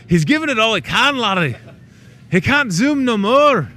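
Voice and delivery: high, loud hammed-up strain; mock desperate cry. Host pleading for the operator zooming in, mock desperate. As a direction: wail it high like an overtaxed crewman.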